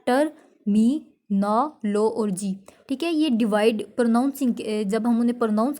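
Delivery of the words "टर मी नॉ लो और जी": slow "टर, मी, नॉ, लो और जी", a break after each syllable. Shown as a demonstration. Teacher counting out syllables for learners, "टर, मी, नॉ, लो और जी" syllable by syllable. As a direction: split "टर, मी, नॉ, लो और जी" into separate, measured syllables. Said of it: "five syllables हैं".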